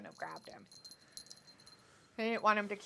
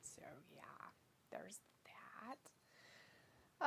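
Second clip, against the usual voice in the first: whispering; 2 semitones lower, 17 dB quieter, much slower than usual. Real, not acted. Trailing off after a fact.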